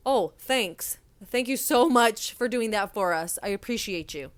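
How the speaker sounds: slow, drawn-out mock gratitude; sarcastic thanks. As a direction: lay the fake gratitude on thick. Mock thanks to rude party.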